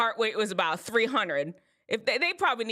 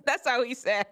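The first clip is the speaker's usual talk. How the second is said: amused, higher, through laughter; laughing; 4 semitones higher than usual. Real, not acted. Speaker amused at own imitation of brother, laughing. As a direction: laugh as you say it.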